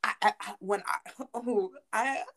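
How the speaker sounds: stumbling over repeated words; real stuttering. Real, not acted. Recalling the vial switch to a friend, stuttering. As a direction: trip and restart on the first word. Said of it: "I'm stuttering 'cause I'm just remembering it".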